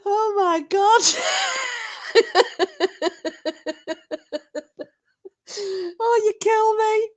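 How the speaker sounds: cry-laugh, barely getting words out; laughing hysterically. Real, not acted. Helpless laughter at chat comment.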